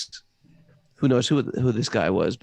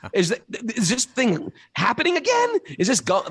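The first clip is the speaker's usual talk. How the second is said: higher, bewildered voice asking; confused visitor; 11 semitones higher than usual. Retold or imitated. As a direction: play a baffled old-timer, half lost. And they'd be like.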